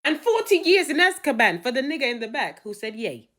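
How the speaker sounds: high, emphatic mock judge; indignant courtroom pronouncement; sentencing judge. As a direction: hand down harsh terms with theatrical force.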